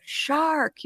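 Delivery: high but restrained call; held-back, high-pitched alert; re-enacted warning. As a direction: call a warning without making much noise. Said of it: "So I said".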